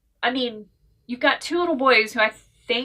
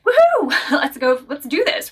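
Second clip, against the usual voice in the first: high, loud whoop, then giddy laughter; thrilled cheer; 4 semitones higher, 5 dB louder than usual. Retold or imitated. Reader spotting the author's adult novel, whooping with joy. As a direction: cheer like a fan who just found the book. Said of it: "I was like".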